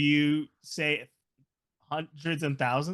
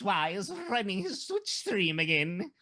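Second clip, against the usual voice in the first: high-pitched mock Cockney relative; hammed-up Cockney, laughing; Cockney accent; 6 semitones higher than usual. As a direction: play a cartoon Cockney family member. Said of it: "everybody has a Cockney accent".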